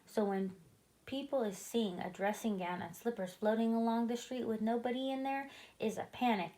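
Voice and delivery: ordinary delivery, nothing marked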